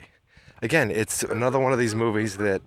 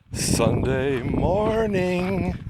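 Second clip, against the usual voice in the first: drawn-out; 7 semitones higher, much slower than usual. Real, not acted. Drawn-out greeting continues.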